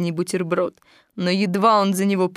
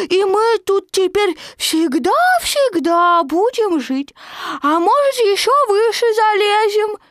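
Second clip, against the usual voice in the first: excited Roo; 10 semitones higher, 4 dB louder than usual. A narrator's reading. Roo explains from up the tree, excited. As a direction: chatter down high and loud.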